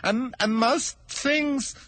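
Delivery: high, slow stammering plea; timid hatter begging pardon; nervous hatter. Acted. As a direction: stammer it out, afraid of the king. Hatter continues.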